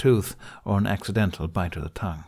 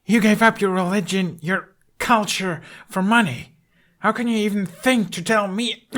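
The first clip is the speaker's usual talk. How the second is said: higher, louder, accusing shout; angry yell; 12 semitones higher, 3 dB louder than usual. Acted. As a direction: throw the betrayal in his face. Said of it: "Hiccup yelled".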